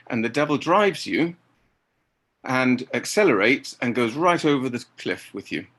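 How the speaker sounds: normal talking voice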